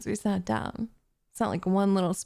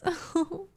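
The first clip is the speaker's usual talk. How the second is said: light giggle; 8 semitones higher than usual. Acted. Witch laughs at cat.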